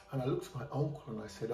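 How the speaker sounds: ordinary delivery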